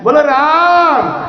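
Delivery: slow, high, flowing बोलो राम with a swell; call with a wave. Shown as a demonstration. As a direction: sing out the call with a wave of love. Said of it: "थोड़ा सा लहर देंगे". (लहर देकर धीमे से पुकारा गया बोलो राम)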